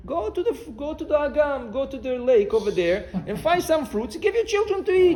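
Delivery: high-pitched mock exasperation, rising to the end; exasperated retort. Husband answering wife about feeding children, mock exasperated. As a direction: wave off the worry in a high, insistent voice.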